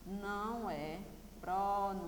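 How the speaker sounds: soft, syllable-by-syllable delivery. Shown as a demonstration. Dictates the answer for writing.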